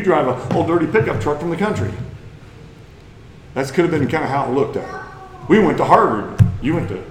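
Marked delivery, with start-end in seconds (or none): 5.5-7.0 snobbish taunt. Retold or imitated. Snob mocks a community college grad.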